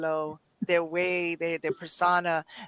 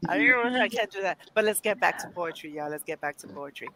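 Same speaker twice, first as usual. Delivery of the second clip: usual speaking voice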